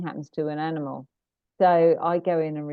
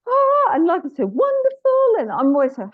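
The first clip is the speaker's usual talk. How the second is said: high, loud, gushing delight; overjoyed dog; 7 dB louder than usual. Retold or imitated. Bouncy dog gushing to the animal communicator, overjoyed. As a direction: burst out like an overexcited dog.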